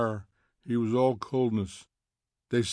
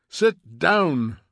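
loud, higher-pitched command; shouted order; 5 semitones higher, 8 dB louder than usual. A narrator's reading. Montag orders three women back to seats, shouting. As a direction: order them down loudly.

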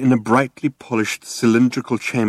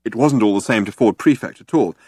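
usual speaking voice

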